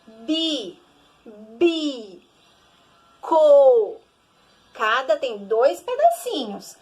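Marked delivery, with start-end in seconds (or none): 0.1-4.0 "bi, bi, co" said as separate beats, the last one loud; syllables split apart. Shown as a demonstration. Teacher to children, splitting the word into syllables. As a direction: split "bico" into its syllables, stressing "co". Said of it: "Por exemplo".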